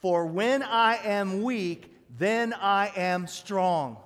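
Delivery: slow rousing declaration said with the church; loud slow call to repeat. Shown as a demonstration. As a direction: lead the room in a second, stronger repeat. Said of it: "Let's say it one more time".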